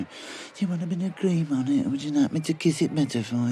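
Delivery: high, cooing mockery of a fussing nurse. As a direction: coo it like a doting nanny, all sarcasm. Mocking the physio's fussing.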